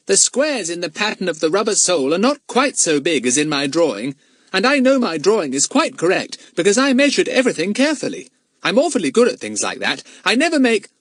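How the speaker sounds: put-on high, cocksure child's voice; self-satisfied young expert; boastful schoolboy. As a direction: show off your expertise without stopping. Plays Fatty boasting.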